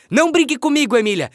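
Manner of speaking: put-on loud, high angry boy; angry shout. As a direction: yell at the doll in anger. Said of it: "gritou Pedrinho, avermelhando de raiva".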